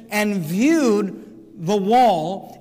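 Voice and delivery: viewed drawn out very slowly; viewed stretched for emphasis; stress on viewed. Shown as a demonstration. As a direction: lean long on viewed. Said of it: "notice the emphasis on vision".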